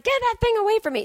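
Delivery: imitating Whoopi telling her train story; Whoopi Goldberg impression. Comedian retelling Whoopi's remarks on The View, mock Whoopi voice. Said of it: "and Whoopi's like".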